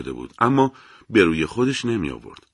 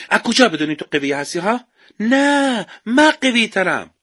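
boastful comeback; 11 semitones higher, 3 dB louder than usual. A narrator's reading. Ruhollah answers boast.

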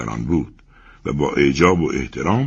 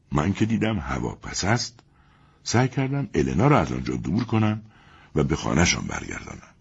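ordinary delivery, nothing marked

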